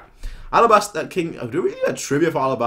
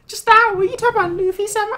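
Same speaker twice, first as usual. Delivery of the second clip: put-on much higher, fast and louder, gushing girl; excited fangirl; 8 dB louder, faster than usual. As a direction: blurt it out like a starstruck fan. Reading fangirl's excited question.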